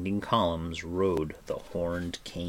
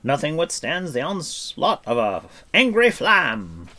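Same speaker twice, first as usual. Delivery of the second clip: loud, high, boastful bard; bold, projected heroic boast; declaring bard; 8 semitones higher, 9 dB louder than usual. A narrator's reading. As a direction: proclaim it grandly with raised voice. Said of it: "Fluder declared".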